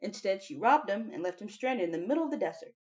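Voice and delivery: plain reading voice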